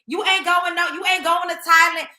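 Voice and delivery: ordinary talk, nothing marked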